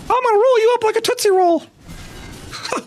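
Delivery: cartoon mouse doing a wrestler promo; Mickey Mouse Savage. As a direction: squeak out a wrestler threat. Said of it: "Mickey Mouse Savage".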